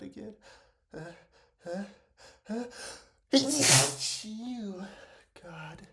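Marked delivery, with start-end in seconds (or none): 3.3-4.8 loud sneeze. Real, not acted. Sneezing on camera.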